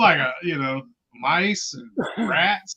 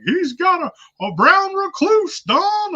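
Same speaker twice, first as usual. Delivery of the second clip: high, loud mock voice of the foreman dad; hammed-up high-pitched foreman voice; dad's warning; 6 dB louder, slower than usual. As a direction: squeal it out like a panicked old man. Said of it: "he'd be like".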